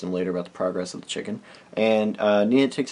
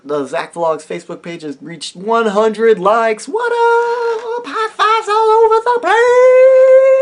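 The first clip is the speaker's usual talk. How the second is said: slow, much higher, shouted hype; excited celebration; 10 dB louder, much slower than usual. Real, not acted. Thanking viewers for a hundred likes, shouting with joy. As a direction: cheer it out to the viewers.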